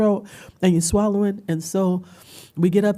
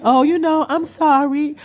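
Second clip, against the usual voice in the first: high, loud mock-apologetic voice; imitated apology; 10 semitones higher, 6 dB louder than usual. Apologizing for her tears, high hammed-up apology. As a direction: exaggerate an apology for crying. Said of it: "try to stop it and be like".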